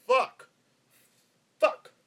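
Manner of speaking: sudden loud, high-pitched bark of one word; emphatic yell. Shown as a demonstration. Podcaster shows listeners how he emphasizes his favourite swear, emphatic yell. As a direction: yell it hard right into the mic. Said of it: "I emphasize the word fuck".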